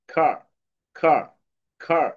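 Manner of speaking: খার with aspirated kh and no r sound; British উচ্চারণে খার, র বাদ; car without the r. Shown as a demonstration. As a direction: say খার, breathy kh, long open a, drop the r. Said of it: "আর এর উচ্চারণটা কিন্তু ওইভাবে হয় না".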